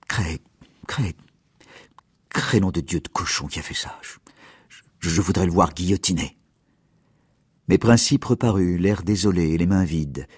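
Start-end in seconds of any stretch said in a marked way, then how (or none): 0.1-6.4 stammering. Acted. Mayor weeping over the murdered girl, stammering whisper. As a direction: stumble over the words, choked up. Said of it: "Il balbutiait".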